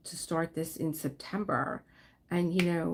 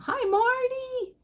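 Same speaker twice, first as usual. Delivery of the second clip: much higher and louder, a bright hello; excited greeting; 6 dB louder, slower than usual. Real, not acted. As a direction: call out happily to a friend arriving. Greeting a viewer joining.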